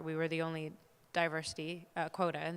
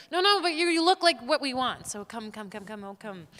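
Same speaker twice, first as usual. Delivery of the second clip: pushy casting director; 5 semitones higher, 8 dB louder than usual. Retold or imitated. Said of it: "but you said".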